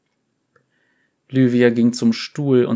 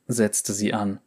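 plain reading voice

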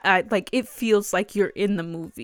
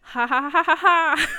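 a spoken, high mock laugh, ha ha ha; 8 semitones higher, 4 dB louder than usual. Real, not acted. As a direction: fake a nervous ha-ha, each syllable spelled out. Nervous laugh at girlfriend mention.

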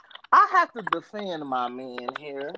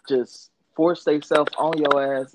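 ordinary talk

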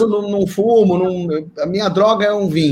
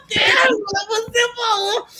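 giggly insistence. Retold or imitated.

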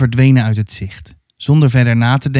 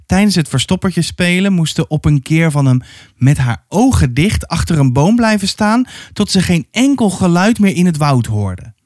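ordinary delivery, nothing marked

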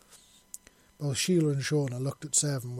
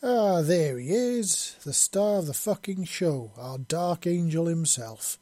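dramatic grinning welcome; 5 semitones higher, 7 dB louder, slower than usual. A narrator's reading. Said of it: "with a bright grin while throwing her arms up to make a dramatic motion".

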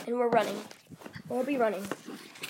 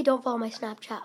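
usual speaking voice

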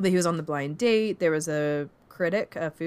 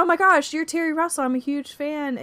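mock fangirl gush, pitched way up; gushing fan; 10 semitones higher, 4 dB louder than usual. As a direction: imitate an excited fan, high-pitched.

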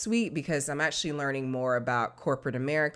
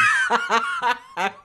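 shrieking laugh; 5 dB louder than usual. Real, not acted. Laughs at eardrum remark.

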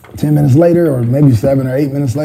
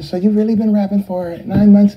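lightly voiced MJ; 5 semitones higher than usual. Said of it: "making that voice that he wasn't making".

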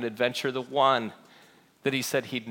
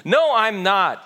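higher, louder, drawn-out denial; sharp retort; 6 semitones higher, 6 dB louder, slower than usual. A narrator's reading. Peter answering an accuser by the fire, retorting. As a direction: retort it emphatically. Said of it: "Peter retorted".

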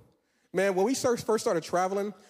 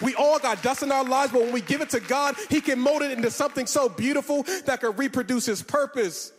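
rising preaching; 5 semitones higher than usual. Acted. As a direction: build it up to a passionate preach. Builds to full preaching force.

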